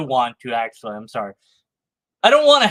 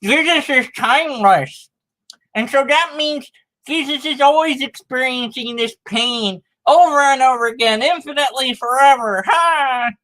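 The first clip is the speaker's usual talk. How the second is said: higher, smug, know-it-all mimicry of an apologist; hammed-up apologist crowing his clever point; mocking apologist voice; 5 semitones higher than usual. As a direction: send up a smug apologist, pitched up. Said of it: "This is how they talk".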